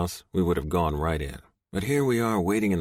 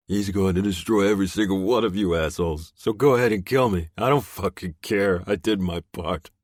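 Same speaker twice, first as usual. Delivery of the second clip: higher, louder mocking thug voice; a beaten man mocks the bikers, loud and higher; taunting captive; 3 semitones higher, 5 dB louder than usual. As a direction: jeer at them, voice raised and higher. Captive defiantly shouting back.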